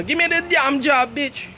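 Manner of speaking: loud, very high mock demand in Jamaican accent; Jamaican accent outburst. Imagined job interview, hammed-up Jamaican accent shout. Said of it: "and I go like that".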